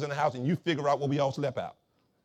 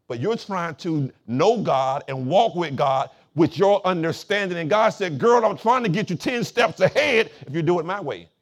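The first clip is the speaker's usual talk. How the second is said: loud, raised-pitch sermon peak; forceful preaching; 5 semitones higher, 7 dB louder than usual. Real, not acted. Pastor voicing God's reply to the congregation, forceful preaching. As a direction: preach it hard, swelling into the quoted answer.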